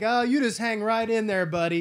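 high, loud, sing-song fake reassurance; mock cheery doctor. Doctor brushing off Seagal's pleas, loud chirpy mock cheer. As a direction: pat him on the head with your voice. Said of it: "And this guy's like".

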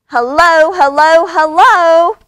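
repeated high phone shout; yelling over noise. A narrator's reading. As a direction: shout to be heard down the line. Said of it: "she yells over the other noise".

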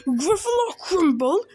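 high, loud shocked question; horrified Gruffalo, pitch jumping; alarmed echo. Acted. Gruffalo hears mouse will eat him, alarmed.

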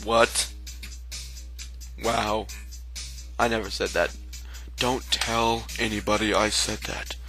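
ordinary talk, nothing marked

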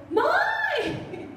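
high yelled call for towel; daughter calling out. Retold or imitated. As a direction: yell for mom to bring a towel, high. Daughter calls from shower.

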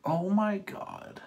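high, loud, slow oh my God; shocked exclamation. Real, not acted. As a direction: gasp out your disbelief. Gasp at the result.

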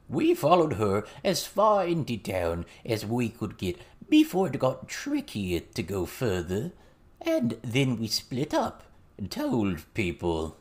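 slow, drawn-out frog-like goblin voice; slow froggy storyteller drawl; croaking goblin witness. A narrator's reading. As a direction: tell it slowly, croaking like a goblin. Goblin character continues his account.

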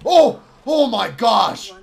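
loud, high "oh" then drawn-out disbelief; shocked outcry. Real, not acted. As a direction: cry out in shock, stretching the words. Shocked by what happens in game.

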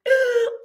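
a high, hammed-up sobbing gasp; mock tearful gasp. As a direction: gasp like she's about to cry. Mocking a crying woman.

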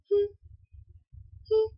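ordinary talk, nothing marked

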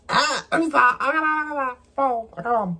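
high, embarrassed squeal of a reply; squeaky flustered. Real, not acted. Asked about her first kiss, squeaky flustered giggle. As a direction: pitch way up, caught off guard.

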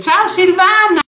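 high, loud sing-song hello; excited greeting. Real, not acted. Host greeting a viewer by name, high and loud. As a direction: call out her name brightly.

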